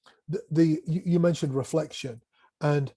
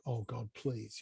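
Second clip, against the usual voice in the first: pleading under breath; 5 semitones lower, 13 dB quieter, slower than usual. Retold or imitated. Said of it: "And I'm like".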